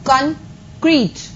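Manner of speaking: "concrete" said clearly as kən-KREET, for the class to copy; pronunciation model. Shown as a demonstration. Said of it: "जैसे मैं बोल रही हूं आपको वैसे ही उस चीज को प्रोनाउंस करना है".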